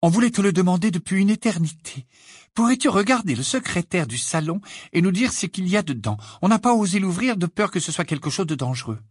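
high-pitched, chatty mother voice; eager mother. A narrator's reading. Mother asking an old friend to check a cabinet, eager. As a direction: ask the favour in a high motherly voice.

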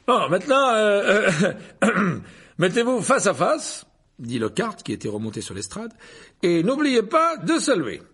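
high, loud, stammering with throat clearing; flustered vain professor. A narrator's reading. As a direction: bluff through the embarrassment. Lockhart instructs the duelists.

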